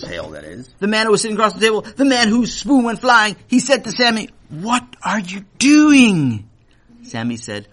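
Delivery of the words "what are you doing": slow, drawn-out puzzled demand; a grown guest asking slowly what the boy is doing; slow put-on grown-up voice; guest's challenge. A narrator's reading. Guest scolds Sammy at table.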